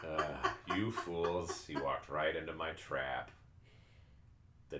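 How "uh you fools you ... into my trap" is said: booming echoing villain monologue. Acted. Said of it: "laugh menacingly".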